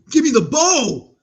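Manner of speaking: high, loud, stretched cheer; excited call. Real, not acted. Fan reacting to the game, excited call. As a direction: holler it at the screen, drawing it out. Calling out while watching game.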